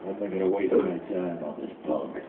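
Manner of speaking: low, soft, scornful voice of Ikaku; low, scornful rival's sneer; dismissive Ikaku. Pretend. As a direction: brush him off from a low, bored height. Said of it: "Ikaku would be like".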